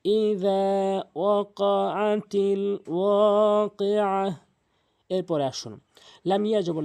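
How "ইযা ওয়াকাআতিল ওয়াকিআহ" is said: very slow, word by word recitation; one by one. Shown as a demonstration. Teacher showing word-by-word reading, very slow. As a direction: say each word separately and slowly.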